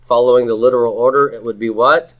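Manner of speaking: ordinary delivery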